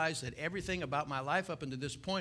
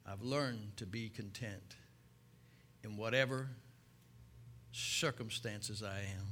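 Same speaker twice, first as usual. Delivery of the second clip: low, much softer reflective preaching; soft sermon; 7 semitones lower, 6 dB quieter than usual. Acted. Preacher to congregation, hushed earnest preaching. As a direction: draw the congregation in quietly.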